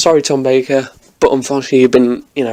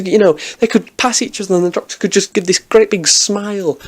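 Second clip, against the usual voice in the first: ordinary delivery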